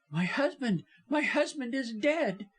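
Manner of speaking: high-pitched, distraught wife; acted distress, rising high; terrified. A narrator's reading. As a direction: blurt the dreadful news, pitch climbing. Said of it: "apparently wild with terror".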